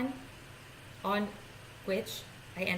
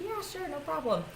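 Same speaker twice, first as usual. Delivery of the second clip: breezy agreeing; 8 semitones higher than usual. Retold or imitated. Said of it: "I said".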